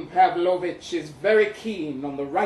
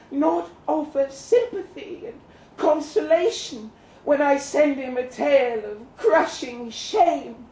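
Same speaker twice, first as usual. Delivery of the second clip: mocking lovelorn ninny; 9 semitones higher than usual. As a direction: mimic a lovesick friend's self-pity. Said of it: "the lovelorn ninny gasps".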